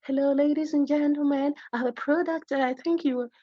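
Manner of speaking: high, put-on posh English accent, ladies and gentlemen; a city salesman in a fake posh English accent; mock posh English accent. Lecturer mocking a suited salesman to fisher folks, in a put-on posh English accent. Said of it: "with brofo, with accent".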